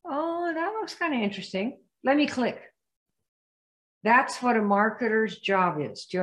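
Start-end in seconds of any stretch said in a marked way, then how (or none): 0.0-2.6 curious, higher voice, drawn in; like someone spotting a catchy ad; intrigued prospect. Retold or imitated. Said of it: "they're gonna go".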